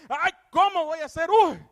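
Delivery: the cry of fear a scared person lets out; panicked cry. Shown as a demonstration. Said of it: "Las voces de miedo es un grito".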